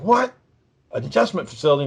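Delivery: imitated disbelief. Retelling brother hearing of the facility, mock disbelief. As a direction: question it as a startled brother would. Brother reacting to his punishment.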